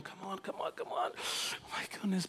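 urgent whisper; whispered pleading under the breath; impatient Jairus. Acted. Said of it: "your Jairus are going".

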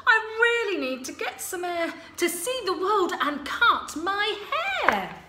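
high, loud, impatient princess voice; a fed-up princess moaning from her tower; bored princess. Acted. Princess Sue complaining, bored.

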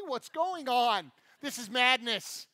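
loud, slow mock disbelief; hammed-up bewildered protest; mock outrage. Acting out kids' disbelief at rentals.

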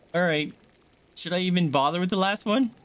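ordinary delivery

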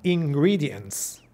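very slow, ingredients stretched; slow model. Shown as a demonstration. Teacher slowly models ingredients for the class. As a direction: drag out ingredients. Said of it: "La entonación de ingredientes es importante".

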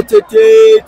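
a squealing burst of excitement; excited shriek. Real, not acted. Commentator as the ball goes forward, a high excited shriek. Commentator calling a rising attack.

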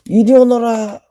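loud period drama call; old-style summons. Pretend. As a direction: boom it like a lord summoning. Calling out in toy character manner.

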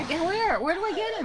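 high, frantic asking; panicked. Real, not acted. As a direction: search in a panic. Searching frantically for dog.

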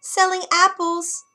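high, squeaky crone voice; squeaky old woman voice. Acted. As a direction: put on a thin squeaky old voice. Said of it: "came a squeaky voice".

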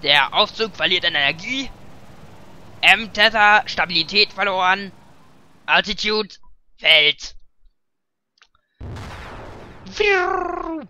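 loud robotic alarm warning; overheating robot. Acted. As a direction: blare like a failing machine alarm. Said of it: "das waren die Sounds".

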